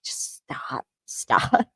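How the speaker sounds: hushed, whispered begging; whispered plea. Retold or imitated. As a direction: whisper it as a weary imitated plea. Voicing Cassie's weary plea.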